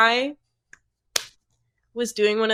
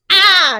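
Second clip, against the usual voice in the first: short sharp hammed-up scream, much higher and louder; mock scream; 11 semitones higher, 15 dB louder than usual. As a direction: let out a playful fake yell of rage. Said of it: "slammed the doors and screamed and cried".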